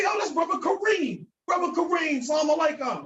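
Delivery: put-on high-pitched English-accented greeting, hard R; mock English speaker. English speaker greeting Brother Kareem, high mock voice. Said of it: "So he's pronouncing it incorrectly".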